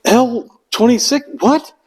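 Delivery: high, baffled questions; confused recruit. Retold or imitated. Bewildered recruit reading bus numbers, high and confused. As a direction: sound lost and confused. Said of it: "And you're like".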